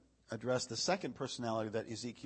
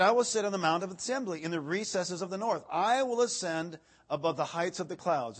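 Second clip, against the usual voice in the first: raised, declaiming pulpit reading voice; scripture reading; 5 semitones higher, 7 dB louder than usual. Acted. Preacher reading Isaiah to the congregation, declaiming. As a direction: proclaim the verse to the room.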